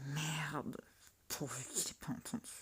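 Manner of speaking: hushed embarrassed aside; embarrassed whisper. Acted. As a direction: mutter to yourself after a slip. Aside after slip.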